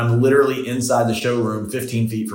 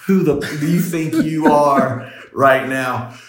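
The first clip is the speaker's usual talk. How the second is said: indignant; 4 semitones higher, 3 dB louder, slower than usual. Retold or imitated. Indignant inner reply to rude salesman.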